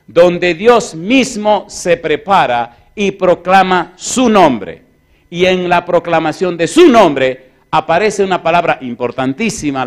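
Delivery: loud, high-pitched pulpit preaching; forceful preaching. Acted. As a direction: drive it home to the congregation.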